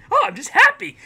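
high, loud innocent cheer; hammed-up happy guy. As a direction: chirp it with exaggerated happiness. Acting the relentlessly happy man.

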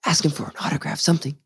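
soft, secretive prompt; whispered urging. Retold or imitated. Dad urging his son to get an autograph, whispering. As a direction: whisper a nudge to your kid.

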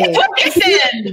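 put-on Hokessen with a German accent. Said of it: "You threw in that German accent".

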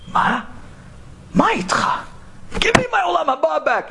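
high, pleading demand; desperate plea. Retold or imitated. Taxi driver begs the Kollel guy for his paper, high and pleading. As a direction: beg for it back, voice straining up.

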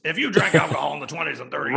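lightly done impression, rattled off quickly; Alex Jones voice. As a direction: slip into a quick comic ranting-host voice. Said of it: "goes back to my Alex Jones voice".

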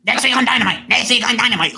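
high mock promo voice, pitched well up; Tony Khan voice. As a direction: ham up the awkward wrestling boss hyping next week. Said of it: "I love your Tony voice".

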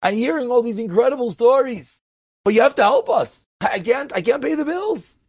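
acted-out appeal, rising in pitch; pleading. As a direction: voice a widow begging her husband for help. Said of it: "she pleads with her husband".

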